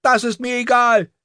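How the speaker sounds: put-on very high girl's yell; shrieking child's angry retort; screaming. A narrator's reading. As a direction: scream back in defiance. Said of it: "schrie Aryeh".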